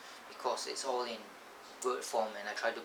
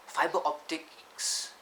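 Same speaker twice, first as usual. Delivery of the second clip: slow correction; 5 semitones higher, 4 dB louder, much slower than usual. Shown as a demonstration. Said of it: "fiber wire is wrong".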